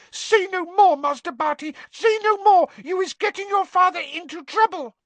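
very high, loud elf wail; shrieked plea. A narrator's reading. Winky begs Master Barty to stop talking, shrieked plea. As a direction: plead in a piercing house-elf shriek.

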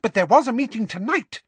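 high, loud, insistent outburst; furious Umbridge. Acted. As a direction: hammer the point, seething. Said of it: "said Umbridge furiously".

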